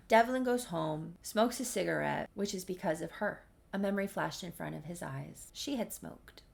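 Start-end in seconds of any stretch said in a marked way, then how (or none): none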